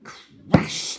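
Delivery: plain reading voice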